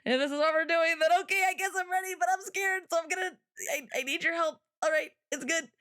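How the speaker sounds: half-acted frightened plea to God; scared pleading prayer. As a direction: pray up high like a frightened newcomer. Retold scared prayer at altar.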